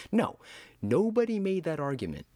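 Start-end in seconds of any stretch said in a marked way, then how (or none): none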